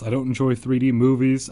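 usual speaking voice

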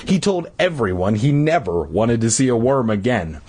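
plain reading voice

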